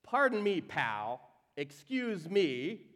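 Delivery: snippy "Pardon me, pal" with attitude; mock-offended retort; sarcastic. Shown as a demonstration. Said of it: "This is like a".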